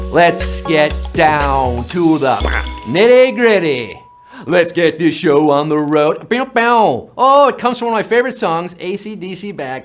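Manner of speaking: normal talking voice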